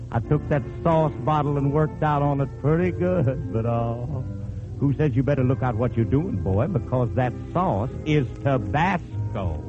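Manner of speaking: ordinary delivery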